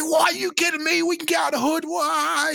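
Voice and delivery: high excited shout; going crazy. Retold or imitated. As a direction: burst out with joy at the news. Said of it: "I was going crazy".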